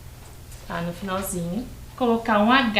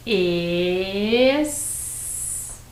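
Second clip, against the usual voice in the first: "Es-" and "co-" drawn out, far apart; syllable by syllable; 3 semitones lower, 4 dB quieter than usual. Shown as a demonstration. Teacher sounds out syllables while building the word, syllable by syllable. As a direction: hold each of "Es-" and "co-" long, as for dictation. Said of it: "segunda sílaba".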